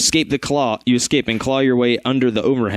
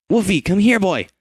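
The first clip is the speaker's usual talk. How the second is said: calling a dog; 8 semitones higher than usual. Acted. Calling the puppy.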